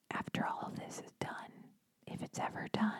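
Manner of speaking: whispered under her breath; whispered aside. Real, not acted. Host whispering a doubtful aside to listeners. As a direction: drop to a whisper, half to herself.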